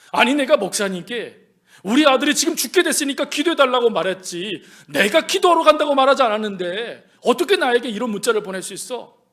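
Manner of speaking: acted indignant complaint; heated, hurt objection; angry outburst. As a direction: protest loudly, as if wronged. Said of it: "하고 막 화를 냈다는 거예요".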